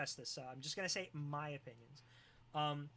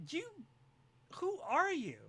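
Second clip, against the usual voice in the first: much higher, alarmed question; high mock alarm; 11 semitones higher than usual. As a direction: squeal it in mock fright.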